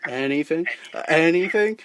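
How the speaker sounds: "anything" with a rising question tune. Pretend. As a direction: lift the end of each "anything" like a question. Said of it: "question mark".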